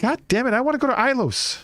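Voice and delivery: high-pitched frustrated protest; exasperated whine. Real, not acted. Player impatient to go to Ilos, exasperated whine. Player whining at quest delay.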